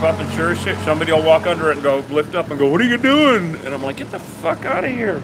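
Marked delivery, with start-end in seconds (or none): 2.7-3.6 higher, louder mock passerby question; raised-pitch busybody voice; nosy passerby. Acted nosy passerby under the tape. 4.0-5.2 higher-pitched acted telling-off; mock outrage at an intruder; exasperated retort.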